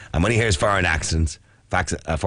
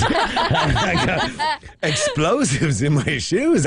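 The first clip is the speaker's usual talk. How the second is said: incredulous surprise; 9 semitones higher, much slower than usual. Retold or imitated. Retelling airport explosives accusation.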